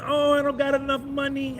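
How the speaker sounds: half-hearted mocking whine; mock excuse-maker. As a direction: mimic a hesitant traveler making excuses. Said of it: "Stop thinking".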